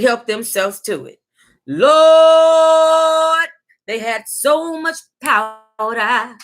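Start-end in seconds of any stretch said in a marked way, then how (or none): 1.7-3.5 loud drawn-out Lord; extra. Real, not acted. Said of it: "I am extra". 3.9-6.4 slow, stretched-out disbelief; extra. Real, not acted. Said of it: "I am extra".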